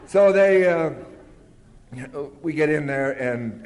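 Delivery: ordinary talk, nothing marked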